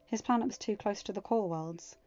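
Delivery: plain reading voice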